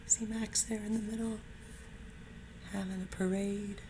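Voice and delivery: lively picture-book read-aloud; animated storybook narration; reading to children. A narrator's reading. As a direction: read it like storytime for first graders. Teacher reads picture book aloud.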